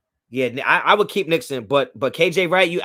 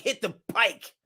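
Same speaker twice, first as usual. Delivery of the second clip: higher, put-on quote voice; mock kick-out; 5 semitones higher than usual. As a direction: mimic a phrase you would never use. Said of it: "I'm not gonna be like".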